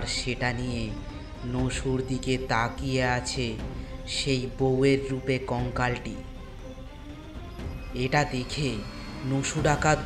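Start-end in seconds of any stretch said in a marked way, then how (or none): none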